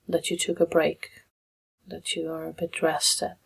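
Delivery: soft meditation guidance with long pauses; guided meditation. Real, not acted. Meditation leader guiding listeners into breath and body, softly and unhurried. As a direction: ease them gently through it, leaving long silences.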